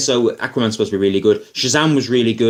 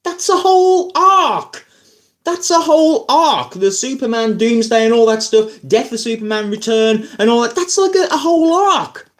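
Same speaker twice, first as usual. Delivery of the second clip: high-pitched exasperated outburst, much higher; exasperated rant; 9 semitones higher than usual. Retold or imitated. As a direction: voice jumps up in disbelief at the wasted story. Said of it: "and I'm like".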